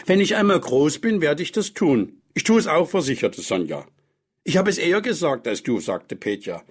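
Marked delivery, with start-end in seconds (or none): 0.1-2.9 put-on high, determined child's vow; eager child. A narrator's reading. Children vowing eagerly in story. 4.4-5.8 put-on high, quick childish one-upping; child squabbling. A narrator's reading. Boy competing with his sister.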